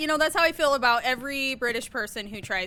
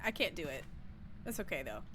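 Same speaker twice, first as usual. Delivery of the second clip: usual speaking voice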